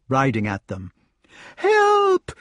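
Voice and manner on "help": very high, loud cry for help; acted scream. A narrator's reading. Lady chased by her husband, screaming to Lancelot. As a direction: scream for rescue in a high woman's voice. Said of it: "screamed the lady".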